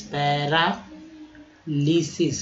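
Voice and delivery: slow drawn-out model word; slow demo. Shown as a demonstration. Teacher repeating the word slowly. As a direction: stretch it for the class. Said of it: "teacher stretches word".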